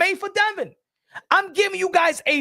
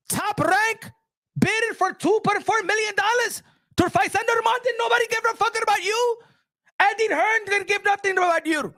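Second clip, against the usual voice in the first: raised, angry yelling boss who paid you; furious Turki Alalshikh; 5 semitones higher than usual. Acted. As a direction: chew them out like a betrayed promoter. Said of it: "If I'm Turkey Al Sheikh".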